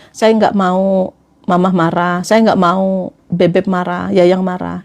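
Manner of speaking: low, slow coaxing of a loved one; gentle request. Shown as a demonstration. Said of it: "kayak gitu". (meminta lembut, lambat, nada rendah)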